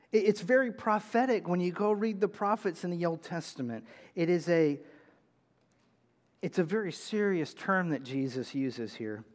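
ordinary talk, nothing marked

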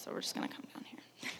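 soft aside whisper. Real, not acted. Preacher steps off her notes, whispering aside. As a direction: whisper as you step down. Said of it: "this isn't in the notes".

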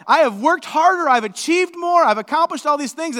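loud, high bragging; preacher mocking our boasting, loud and high; mock self-praise. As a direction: brag like we would. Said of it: "that's the way that our boasting would go".

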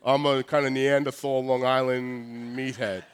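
low, dim Neanderthal meathead voice; dopey Long Island meathead. Acted. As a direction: play a slow-witted Long Island lug. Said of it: "we're just like".

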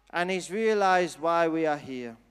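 normal talking voice

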